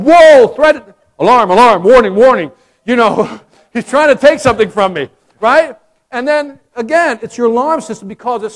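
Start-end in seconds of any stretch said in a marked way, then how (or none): 0.0-2.5 loud, high alarm-siren calling; brain alarm. Retold or imitated. Brain sounding the alarm at the loss, loud siren call. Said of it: "this part of the brain going". 3.7-5.1 loud indignant protest; brain alarm. Retold or imitated. Brain protesting the clerk taking candy, loud indignant. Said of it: "this part of the brain going".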